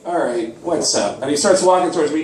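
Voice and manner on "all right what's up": low, unenthused greeting; grudging acknowledgement of a fan; reluctant. Acted. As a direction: answer reluctantly, much lower in pitch. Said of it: "reluctantly he turns around like".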